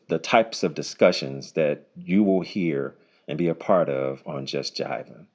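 ordinary delivery

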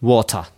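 "water" with a crisp, exploded T; British-style "water", no R at the end; British pronunciation. Shown as a demonstration. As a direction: release the T in "water" clearly. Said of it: "イギリス英語だと水はwater".

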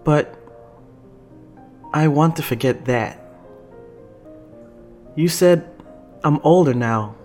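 hesitant, earnest plea; holding back tears. A narrator's reading. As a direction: push on gently through welling feeling. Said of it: "he's trying not to cry".

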